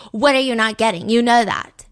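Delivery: loud retold challenge, punched ending; exasperated demand. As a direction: act out her angry comeback. Acting out her angry response.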